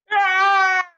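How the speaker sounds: high, loud whoop; loud cheer. Real, not acted. Bystander whooping as the girl stands up. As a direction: let out a big whoop. Cheering her escape loudly.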